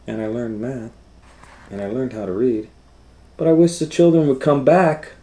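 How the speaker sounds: loud, high, eager storybook voice; reading to children. Acted. Teacher reads Ruby's words to the class, loud and bright. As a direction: build it up brightly for the kids. Reads picture book aloud.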